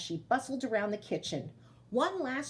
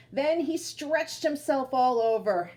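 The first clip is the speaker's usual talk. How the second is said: slow, drawn-out read-aloud voice; storytelling narration; 3 semitones higher, 5 dB louder, slower than usual. A narrator's reading. Teacher reading to children, slow storybook narration.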